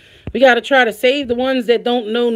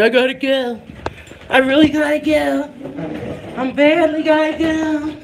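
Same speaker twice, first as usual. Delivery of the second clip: mimicking a whining child; whiny kid voice; 7 semitones higher than usual. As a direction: imitate a kid who badly needs to go. Mother mimics son's whining.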